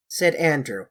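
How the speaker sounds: ordinary delivery, nothing marked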